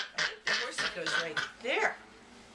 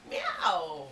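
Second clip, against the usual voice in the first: high-pitched cat meow; squeaky kitten meow for a toddler; high meow imitation; 11 semitones higher than usual. Voicing the kitty for toddler.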